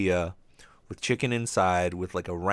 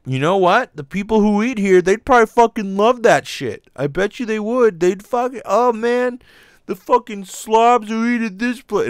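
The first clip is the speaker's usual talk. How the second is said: higher, louder hyped-up boardroom voice; excited exec; 11 semitones higher, 7 dB louder than usual. Retold or imitated. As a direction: pitch it up and crank the volume like a giddy executive. Boardroom execs cheering the idea.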